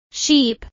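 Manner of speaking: SHEEP with a long, tense ee; long vowel. Shown as a demonstration. Teacher drilling learners on vowel sounds, long ee in Sheep. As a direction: hold the ee in Sheep. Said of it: "is a long I sound".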